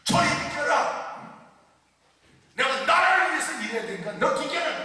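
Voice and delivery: acted-out shout, high and loud; thundering scolding. Sixteen-year-old girl rebuking a tiger on a night road, thundering scolding. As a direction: order the tiger aside in a retold roar. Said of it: "호랑이한테 막 호통을 치는 거예요".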